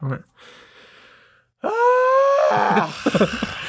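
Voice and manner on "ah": high sudden startled shriek; weird scream. Retold or imitated. Retelling his scream at a man in a one-way aisle, high shriek. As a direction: let out a strange yelp as if startled. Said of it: "I screamed like that, like that weird noise".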